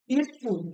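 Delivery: স্কুল said as "iskul", opening on a short "i"; added initial vowel. Shown as a demonstration. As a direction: begin স্কুল with "ee" before the s. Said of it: "যদি hostel আগে চলে আসবে".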